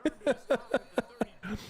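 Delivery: high breathy laugh breaking out; a real high laugh; burst of laughter. Real, not acted. Laughs at own rant.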